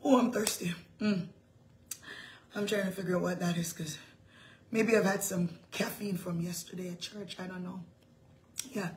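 real low, very soft aside; calming the throat. Real, not acted. Speaker pausing to calm her throat, quiet and low. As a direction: rest the voice, low and subdued. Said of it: "let me calm my throat down".

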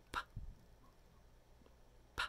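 "P" as a tiny unvoiced pop; quick burst. Shown as a demonstration. Teacher showing it can't last, "P" in one quick pop. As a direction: puff out "P" very short. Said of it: "That one's really fast".

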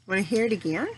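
ordinary talk

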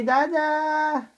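long, held-out call; drawn-out demonstration call; calling for Dada. Shown as a demonstration. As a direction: stretch the last vowel, calling to dad. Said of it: "Come on, call Dada".

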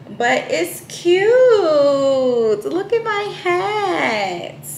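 high, slow, cooing admiration. Pretend. Admiring her new hat to viewers, high slow coo.

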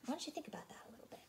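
fast soft whisper. Real, not acted. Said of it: "whispered aside to listeners".

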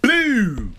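sudden high yelled cry; shout. Retold or imitated. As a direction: blurt it out loud in shock. Mom hits ground, shout.

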